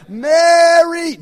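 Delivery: long, high shouted call; re-enacted search yell, drawn out at full volume. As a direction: holler the name far across the wilderness. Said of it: "So I start yelling".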